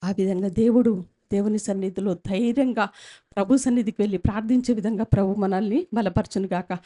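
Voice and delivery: ordinary talk, nothing marked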